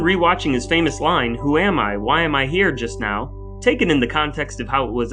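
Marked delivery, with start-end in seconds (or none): none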